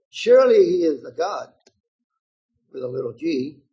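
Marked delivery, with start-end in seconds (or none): 0.1-1.5 louder mock certainty; taunting. A narrator's reading. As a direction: needle them with pretend conviction. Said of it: "began to taunt them".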